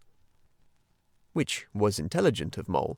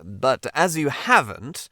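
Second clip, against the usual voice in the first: unkind interruption; 3 semitones higher, 5 dB louder, slower than usual. Acted. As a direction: cut in curtly, slower and a little louder. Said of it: "interrupted the Rat, rather unkindly".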